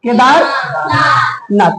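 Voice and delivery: Kedarnath said very slowly, syllable by syllable; teacher spelling out Kedarnath slowly for the students; drawn-out demo. Shown as a demonstration. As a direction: stretch Kedarnath out for the class. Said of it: "और क्या होगा".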